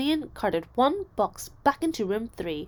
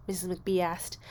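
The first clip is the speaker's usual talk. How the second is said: ordinary delivery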